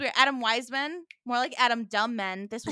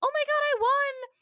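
very high, loud shriek; mock excited squeal; 6 dB louder than usual. Mocking Missy's delayed reaction, high squeal. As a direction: shriek the line like the actress. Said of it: "holding in the line".

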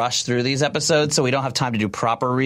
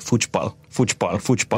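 Brazilian Portuguese warm-up; 3 semitones lower than usual. Retold or imitated. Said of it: "the Brazilian guys or Portuguese guys".

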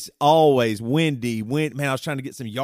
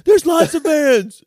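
put-on higher and slower, little-kid protest; whiny kid; 12 semitones higher, 6 dB louder, slower than usual. Daughter insisting to dad there are many vans, whiny and high. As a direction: play the kid defending herself. Kid's defensive reply.

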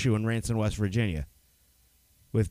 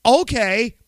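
high, loud, instant yes; eager agreement; 10 semitones higher, 8 dB louder than usual. Retold or imitated. Roommate accepting the designated driver deal, eagerly. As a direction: jump at the offer with mock enthusiasm.

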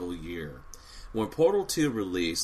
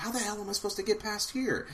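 high frustrated inner voice; 11 semitones higher, 3 dB quieter, faster than usual. Retold or imitated. Frustrated puzzle player inner thought.

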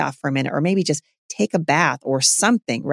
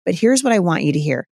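ordinary talk, nothing marked

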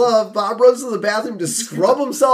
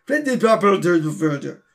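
garbled mutter. Retold or imitated. Repeats threat as garbled foreign muttering.

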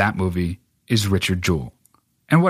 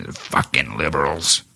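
Clint impression; 5 semitones lower than usual. Host imagining the director's motive, low grumbling impression. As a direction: grumble it low, drawing out the curse. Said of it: "you can just see Clint behind it".

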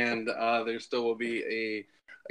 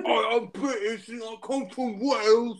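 mock British accent; 8 semitones higher than usual. Joking claim of being British.

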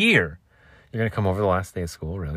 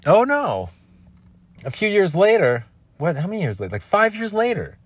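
high, excited storytelling; dramatic reveal; 10 semitones higher than usual. Real, not acted. Telling listeners the story's twist, excited.

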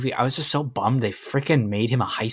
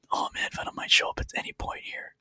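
whispered, hoping Venom appears; hopeful whisper; 6 dB quieter than usual. Retold or imitated. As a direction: breathe it out like a secret hope in the theater. Quietly hoping in theater.

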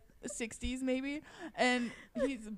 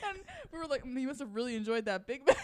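laughing; 9 semitones higher than usual. Real, not acted. Telling funniest part laughing.

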